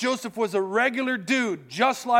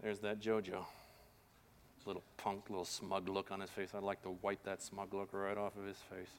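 muttered grudge; 12 semitones lower, 19 dB quieter than usual. Retold or imitated. Imagined grudge at high school reunion.

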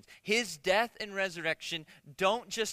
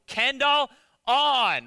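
slow, higher, exaggerated enunciation; loud, over-clear command; 6 semitones higher, 9 dB louder, much slower than usual. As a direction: spell it out loud as if to a deaf machine. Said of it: "say it as clear as possible".

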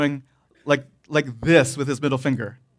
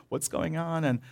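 softer, puzzled murmur; hushed onlookers; 8 dB quieter than usual. Retold or imitated. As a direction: mutter like confused strangers behind magazines.

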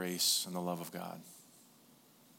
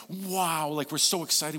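put-on high, thrilled wow like a kid at Christmas; excited wow; 5 semitones higher, 3 dB louder than usual. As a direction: gasp out a delighted wow. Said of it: "We're like".

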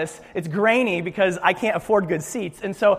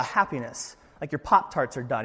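ordinary talk

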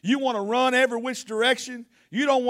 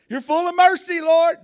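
louder, higher praise cry; preaching at full force; 8 semitones higher, 7 dB louder than usual. Acted. Preacher crying out God's mercy to the church. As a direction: lift it up and proclaim it.

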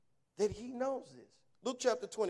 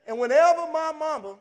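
normal talking voice

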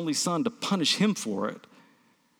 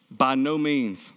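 slow, low and level; straightforward monotone; 4 semitones lower, slower than usual. Real, not acted. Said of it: "said it kind of just straightforward and monotone".

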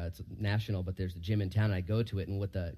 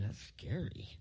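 a much softer, hushed aside; quiet inner thought; 10 dB quieter, slower than usual. Retold or imitated. Preacher recalling what he thought about the cult's records: hushed aside. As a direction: murmur it under your breath, unsettled. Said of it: "I'm just thinking".

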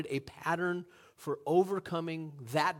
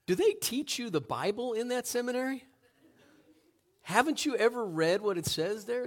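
higher-pitched, mocking questions; sarcastic; 6 semitones higher than usual. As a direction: needle the seminary student. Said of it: "Tom smiled sarcastically".

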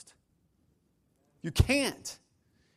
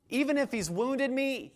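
high, doubtful questioning; acted objection; 6 semitones higher than usual. Preacher voices a wounded spouse doubting forgiveness, high objection. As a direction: protest as the hurt spouse.

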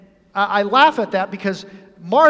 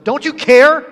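loud, higher, accusing question; irritated demand, pitched up at the end; 6 semitones higher, 10 dB louder than usual. Retold or imitated. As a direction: snap it with mock irritation. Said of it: "It was with a bit of an irritation".

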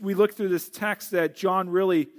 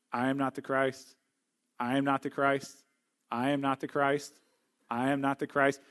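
low, soft, monotone imitation of classmates; awkward recitation; 5 semitones lower, 5 dB quieter than usual. Seminary students take turns around the room, awkward recitation. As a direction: mimic students dutifully reciting one by one, quietly. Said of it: "Kinda awkward, kinda strange".

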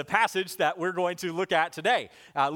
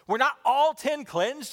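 high, slow, incredulous question; dumbfounded question; 7 semitones higher, 4 dB louder than usual. Acted. Jesus asking after the missing lepers, dumbfounded. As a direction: ask it in raised-pitch disbelief. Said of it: "Jesus dumbfounded".

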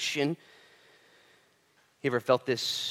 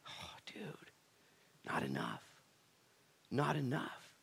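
hushed answer; 11 dB quieter, slower than usual. Acted. Preacher answering how many are saved, hushed and grave. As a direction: drop it to a whisper.

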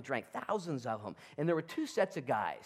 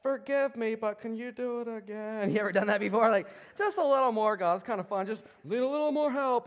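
higher, louder, coaxing; wheedling Gideon; 7 semitones higher, 5 dB louder than usual. Acted. Gideon asking God for another fleece, coaxing. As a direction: beg sheepishly for one more sign.

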